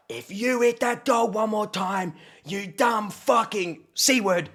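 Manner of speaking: slow, high-pitched threat at normal volume; re-enacted furious threat. To the man hitting his dog, acted slow high threat. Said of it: "I go off my head".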